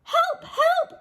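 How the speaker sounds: very high, loud squeal; panicked cry for help. A narrator's reading. Hippo leaps into hamster's arms, squealing for help. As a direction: shriek like a terrified hippo. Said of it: "cried Hippo".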